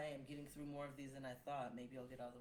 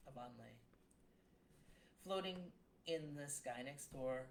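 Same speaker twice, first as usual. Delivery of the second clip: deliberate, measured spoken verse; poem reading; 5 dB louder than usual. Acted. Poet reading a grief poem aloud, measured and heavy. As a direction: let each image land before moving on. Reads poem about mother's death.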